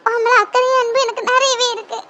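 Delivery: ordinary talk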